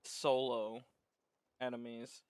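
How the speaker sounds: ordinary talk, nothing marked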